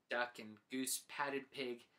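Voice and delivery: ordinary delivery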